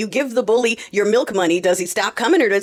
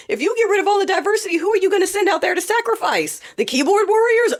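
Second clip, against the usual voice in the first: much higher, fast, mocking disbelief; incredulous sarcasm; 10 semitones higher than usual. Real, not acted. Mocking who would be sent to war, high sarcasm. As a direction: ridicule the idea with rising pitch.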